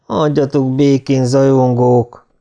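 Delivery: ordinary delivery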